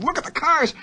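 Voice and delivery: high mock call; high voiced eager call; imitating father. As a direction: parrot dad's eager shout. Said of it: "I said".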